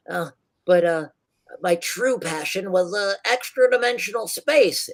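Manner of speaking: high, eager inventor voice; high chatty tinkerer; rambling gnome inventor. Acted. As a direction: tell his backstory fondly. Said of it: "he says".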